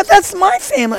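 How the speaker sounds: high-pitched retold disbelief; astonished repeat. Repeats his astonished outburst.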